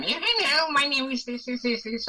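goofy put-on voice like a class clown showing off; silly voice. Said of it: "go on all silly".

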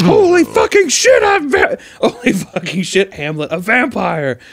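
high, slow, panicked Lincoln; frantic shriek. Retold or imitated. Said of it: "Abraham Lincoln at the play where he's like".

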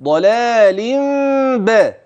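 ضلال با chanted as "dalālimm-bā", the م hummed; hidden mīm with ghunna. Shown as a demonstration. Teacher showing hidden م between ضلال and با, hummed. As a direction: turn the tanwīn of ضلال into a held nasal m before با. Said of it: "هكذا".

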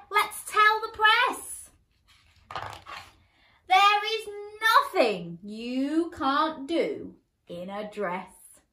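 high, loud, slow delighted announcement; proud queen declaring it; clapping queen. Acted. Queen cheers the princess before everyone, slow delighted call. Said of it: "The queen clapped and said".

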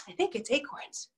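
unvoiced whispered aside. Real, not acted. Teacher whispers an aside to the kids. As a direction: drop to a whisper.

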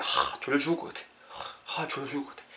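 soft, breath-heavy drowsy groan; acted sleepiness. Teacher illustrating "sleepy", acted drowsy sighing. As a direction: sigh it out quietly, as if dropping off. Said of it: "I'm really sleepy".

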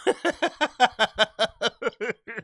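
real quiet, rapid chuckle; amused laugh. Real, not acted. Host reacting to a rapper's threat, amused laugh. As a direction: laugh softly in a long run.

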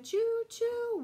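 high, loud sung choo choo; a playful whistle for the kids; train whistle choo. Acted. Train whistle sound in rhyme.

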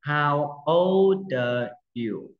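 slow, drawn-out drill of the question; slow model phrase. Shown as a demonstration. Teacher drilling pupils, very slow. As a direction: stretch it out so young pupils can copy. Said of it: "Chưa?".

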